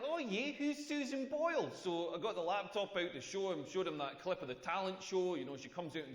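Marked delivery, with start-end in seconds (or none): 0.0-1.7 put-on Australian accent. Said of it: "He was like".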